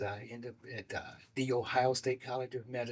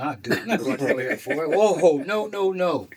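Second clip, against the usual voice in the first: louder refusal, laughing through it; 4 semitones higher, 8 dB louder than usual. Retold or imitated. Turning down his friend's Air Force idea, laughing and loud.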